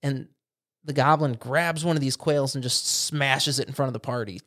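normal talking voice

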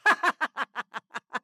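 high loud cackling laugh; hare's burst of laughter. Acted. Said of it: "The hare burst out laughing".

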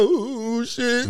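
imitating a wailing mourner; mock crying. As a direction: put on a drawn-out funeral moan. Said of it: "everybody in that bitch crying".

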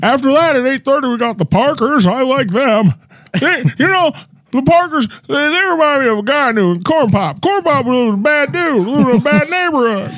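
high-pitched rambling president impression; hammed-up politician voice, pitched well up; Joe Biden. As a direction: announce shows, then wander off as a comic politician. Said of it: "It's your favorite president".

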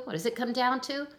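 normal talking voice

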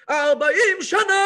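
hammed-up cantor roar. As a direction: bellow it like a cantor showing off his range. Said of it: "אז הוא שואג".

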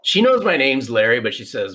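usual speaking voice

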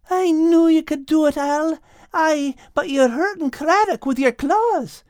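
very high-pitched, kindly character voice; pleased Kate. Acted. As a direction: praise then gently caution, pitched high. Kate cheers, then cautions Al.

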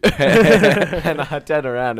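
laughing through the words; words broken by laughter. Real, not acted. Laughing while telling story.

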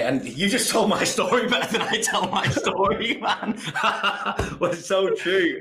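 bursting into a high, delighted laugh mid-sentence. Real, not acted. Guest laughing as host tells his story better. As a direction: laugh it out, caught off guard by praise.

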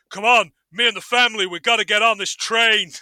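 loud, urgent rallying call; rally the family like an action hero; hammed-up film hero. Voicing imagined train film hero.

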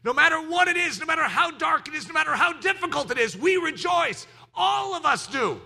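high, driving, emphatic declaration; rousing pulpit proclamation; full-force preaching. Real, not acted. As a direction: preach it with mounting fervor.

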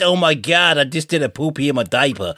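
hammed-up American regional accent; mocking American accent. Brit teasing co-host about regional accents, mock American accent. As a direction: put on a silly, exaggerated American accent. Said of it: "the one that sounds like that".

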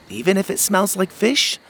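put-on a cheeky little boy asking; young boy.

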